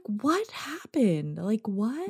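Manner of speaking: hoog, verbijsterd en zacht gevraagd; stunned, slow and quiet disbelief; bewildered what happened. Retold or imitated. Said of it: "And it's exactly in that tone".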